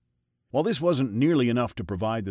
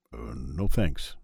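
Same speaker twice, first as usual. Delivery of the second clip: much lower and slow, hesitant; low slow polite refusal; 10 semitones lower, much slower than usual. A narrator's reading. God declines Freud's offer, low and slow. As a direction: turn it down slowly in a low voice.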